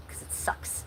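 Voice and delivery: breathy unvoiced whisper; confiding whisper that the beach sucks; whispered aside. Real, not acted. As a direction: drop it to a secret. Whispered aside about Edgewater.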